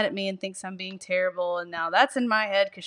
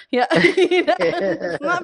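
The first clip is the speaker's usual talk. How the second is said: laughing, higher and louder; 9 semitones higher, 7 dB louder, slower than usual. Real, not acted. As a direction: burst out laughing mid-phrase. Laughing at own tennis story.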